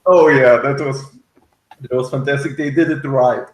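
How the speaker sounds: ordinary delivery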